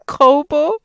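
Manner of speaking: laughing through the name. Acted. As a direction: chuckle as you say Kobo. Laughing mid-quote.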